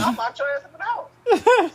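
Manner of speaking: slow, loud, high laughing threat; mock threat. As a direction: ham up mom's threat. Mom's threat retold.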